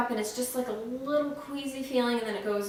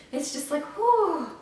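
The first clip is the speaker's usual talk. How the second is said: winded whew; 6 semitones higher than usual. Real, not acted. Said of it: "I'm really out of breath".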